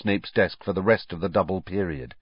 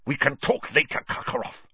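higher, spat-out dismissal; Snape, spitting; 7 semitones higher than usual. A narrator's reading. Snape dismissing Karkaroff, spat out. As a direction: spit the words to end it. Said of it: "spat Snape".

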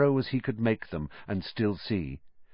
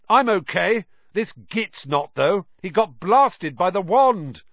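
higher, louder call from behind rocks; calling back; 10 semitones higher, 6 dB louder than usual. A narrator's reading. Ron answering Harry through rocks, loud higher call.

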